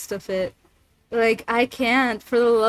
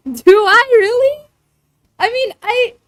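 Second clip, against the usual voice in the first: high, loud, incredulous question; surprised; 10 semitones higher, 6 dB louder than usual. Real, not acted. Streamer told the character got scary, surprised. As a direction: ask it in high-pitched disbelief.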